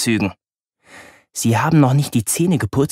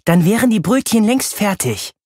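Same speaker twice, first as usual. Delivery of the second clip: higher, teasing big-sister voice; higher-pitched mocking girl's voice; teenage sister Laura; 9 semitones higher than usual. A narrator's reading. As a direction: needle your little brother.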